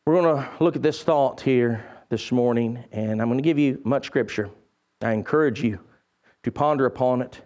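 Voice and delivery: hushed preacher voice; low sermon voice. Acted.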